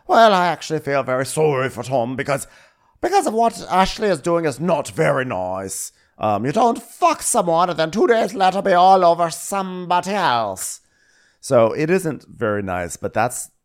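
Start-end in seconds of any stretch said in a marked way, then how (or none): 0.1-10.8 high put-on voice, earnest and emphatic; loud-edged, high mock-indignant imitation; imitating Daisy. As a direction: play an opinionated crewmate setting things straight. Acting Daisy's opinion in put-on voice.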